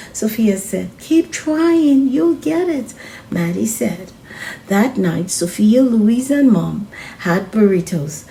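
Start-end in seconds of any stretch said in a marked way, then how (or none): 1.0-2.9 bright higher call of encouragement; cheerful encouragement. Acted. Friend cheering her on from above, higher and encouraging.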